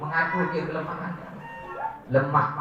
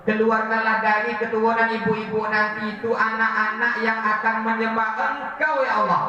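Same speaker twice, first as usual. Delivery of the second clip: earnest prayer; 5 semitones higher than usual. Acted. Prophet's prayer for Taif.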